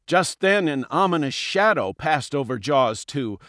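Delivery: usual speaking voice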